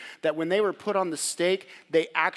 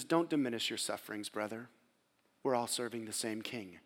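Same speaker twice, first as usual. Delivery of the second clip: tender counsel; 7 semitones lower, 10 dB quieter than usual. Retold or imitated. Quoting pastor's tender advice.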